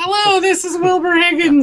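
very high, squeaky helium voice. Acted. Player mocking Higgins's modulated voice on a call, helium voice. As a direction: introduce yourself as if on helium. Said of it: "like he just inhaled a bunch of helium".

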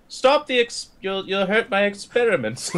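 high mocking whine; mocking the scientist's fussy plea. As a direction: parody the scientist. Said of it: "he's like".

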